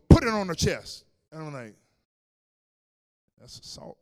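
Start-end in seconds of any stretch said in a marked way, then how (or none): 0.1-1.0 loud, high urgent order; shouted command. Retold or imitated. 3.4-4.0 muttered aside. Retold or imitated. His hushed thought, in my mind.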